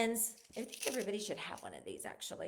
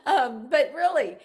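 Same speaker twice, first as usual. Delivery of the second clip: words through laughter, much louder; still laughing; 3 semitones higher, 13 dB louder than usual. Real, not acted. Mother recovering from joy about son's wedding, laughing. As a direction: settle back while still laughing.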